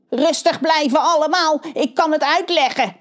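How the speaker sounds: high, scratchy crow voice; crow caw voice. A narrator's reading. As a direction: croak it like a crow. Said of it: "krast hij".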